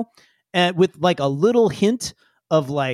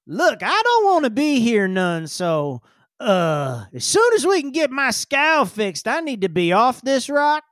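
higher-pitched, grumbling character voice; reluctant spacer; 5 semitones higher than usual. Acted. Game master as Wally wants off this rock, grumbling character voice. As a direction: grumble like someone stranded and wanting out.

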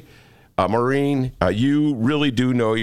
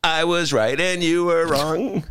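mock gloating; 4 semitones higher, slower than usual. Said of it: "Maureen O'Donnell jumped up and down and goes".

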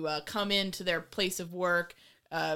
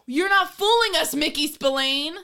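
higher and louder, a half-joking yell; playful raised-voice jab at the film; mock exasperated call-out; 6 semitones higher, 6 dB louder than usual. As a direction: call him out like a fed-up viewer. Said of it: "and it's like".

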